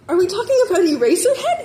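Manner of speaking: high, loud, eager question with a laugh; excited. A narrator's reading. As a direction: burst in thrilled about a favourite hero. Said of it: "Izuku asked excitedly".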